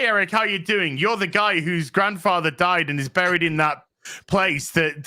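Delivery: ordinary talk, nothing marked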